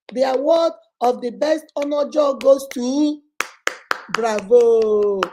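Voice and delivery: mock-grand presenter; mock award announcer. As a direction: announce a sham prize with sarcastic pomp.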